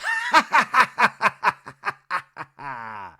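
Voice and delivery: loud staccato cackle, pitched high; hammed-up burst of ha-ha-ha; mock laugh. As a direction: imitate a loud machine-gun laugh. Retelling Jesse's reaction, imitated laugh.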